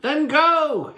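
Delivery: a high pleading call. Acted. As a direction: beg him to stay. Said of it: "Herschel pleaded".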